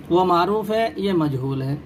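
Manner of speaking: ordinary talk, nothing marked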